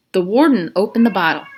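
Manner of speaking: usual speaking voice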